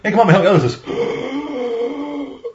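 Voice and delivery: slow, high whining voice, close to crying; whiny plea. Retold or imitated. Imitating the guy asking the hitman for help, slow high whine. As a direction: whine it out like a helpless lackey.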